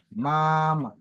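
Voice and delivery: MAMA with first syllable held high and level, then dropping; first-tone example. Shown as a demonstration. Teacher giving a first-tone word, MAMA high and drawn out. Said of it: "Comme un exemple de ma".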